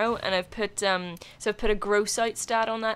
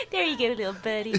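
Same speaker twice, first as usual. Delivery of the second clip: Butters impression; 2 semitones higher than usual. Gamer imitating Butters healing a teammate, cute impression. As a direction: put on a sweet little buddy voice. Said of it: "So adorable when he heals you".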